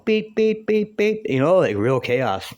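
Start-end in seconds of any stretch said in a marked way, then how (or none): 0.1-1.2 much higher, clipped electronic beeps; imitated hospital beeper. Narrator voicing the hospital monitor, high mimicked beeps. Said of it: "You hear the beeper going".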